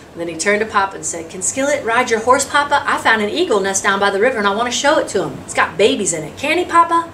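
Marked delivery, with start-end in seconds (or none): 1.3-7.0 put-on higher-pitched pleading child; a hint of an excited young son, pitch climbing at the end; lightly voiced eager boy. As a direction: beg Papa eagerly, lifting the final question. Voices eager boy asking father.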